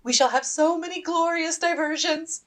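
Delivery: thrilled to announce the fun; gleeful. Acted. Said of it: "clapping her hands to applaud".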